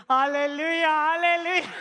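loud, exultant praise; over-the-top praise shout; jubilant hallelujah. Retold or imitated. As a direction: belt out a comic burst of thanks to God. Bursts into praise at free ride.

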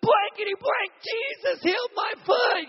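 very high, loud, astonished yell; high shouted "my foot"; amazed screaming. Retold or imitated. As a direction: shriek in disbelief at the healing. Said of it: "she goes".

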